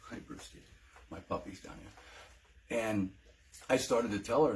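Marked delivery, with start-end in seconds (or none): 0.1-2.8 whisper, much softer, to the puppy; hushed aside so the dog stays calm. Real, not acted. Whispering hello to his puppy Brewski, soft and hushed.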